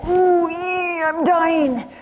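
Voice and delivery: high, drawn-out dying wail; storyteller's mock death wail; retold wailing cry. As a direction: imitate a dying woman's long, high cry. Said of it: "she cried".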